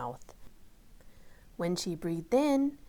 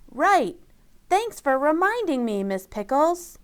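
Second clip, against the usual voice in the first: eager; 7 semitones higher, 5 dB louder than usual. Acted. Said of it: "Rosie jumped up".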